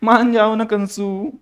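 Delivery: slow, high, pleading sweet talk; mock girlish whine, drawn out; girlish coaxing. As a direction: plead like a girl sweet-talking. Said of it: "लड़कियां तो ऐसा भी कर सकते हैं".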